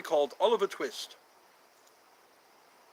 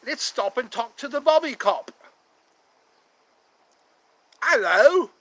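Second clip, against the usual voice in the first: much higher, louder posh English gentleman; a fussy Victorian Londoner, hammed up; 7 semitones higher, 5 dB louder than usual. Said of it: "who talks like that".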